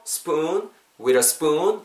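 "spoon" drawn out long; long vowel. Shown as a demonstration. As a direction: stretch the oo in "spoon". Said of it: "숟가락은 길죠?".

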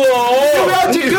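slow, high, loud complaint; frustrated yell. Retold or imitated. Retelling the hi-pass card not scanning, drawn-out yelling. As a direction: drag out an exasperated shout. Acting out the failed card scan.

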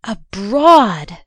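loud, amazed exclamation, falling at the end; eager cry. Acted. Jo hearing Laurie has lived abroad, crying out. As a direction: burst out with delighted interest. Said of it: "cried Jo".

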